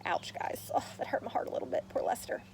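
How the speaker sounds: soft whisper; whispered aside. Real, not acted. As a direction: whisper it to the listeners in sympathy. Narrator's sympathetic aside to listeners.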